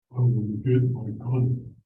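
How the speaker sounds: put-on low, slow, grim old man; elder in the dark theater, low and slow; old elder's voice. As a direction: growl it out like a ninety-year-old elder. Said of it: "I knew his voice".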